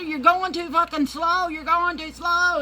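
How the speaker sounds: high, loud, urgent nagging; frantic complaint. Retold or imitated. Panicked passenger nagging Wanda at the wheel, loud. High, yelling at slow driver.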